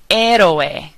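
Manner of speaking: Spanish "héroe", starting straight on the E; silent H. Shown as a demonstration. Teacher showing the second practice word, silent H in "héroe". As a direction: say "héroe" without the H, as "EH-ro-eh". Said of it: "we're not pronouncing the H".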